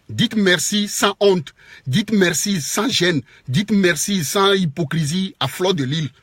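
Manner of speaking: each word laid out slowly and firmly; slow emphatic demand. Real, not acted. A vlogger slowly lecturing followers to say thanks, emphatic. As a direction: drag out the lesson so they get it.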